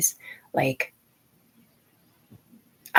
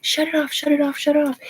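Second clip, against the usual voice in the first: imitated screaming; 10 semitones higher than usual. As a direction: mimic frantic women screaming without full volume. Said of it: "These women were screaming out of my head".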